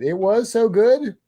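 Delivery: usual speaking voice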